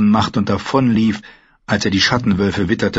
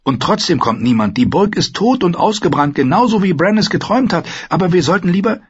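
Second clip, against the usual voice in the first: wildling woman; 10 semitones higher than usual. A narrator's reading. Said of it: "plötzlich verstummte sie".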